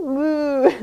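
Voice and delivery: slow playful moo; cow moo. Shown as a demonstration. Teacher mooing like a cow for a child in cat-cow. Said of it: "Let me hear it".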